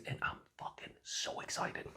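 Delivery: excited words in a full whisper. Real, not acted. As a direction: breathe it out with no voice, hushed. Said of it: "I don't know why I'm whispering".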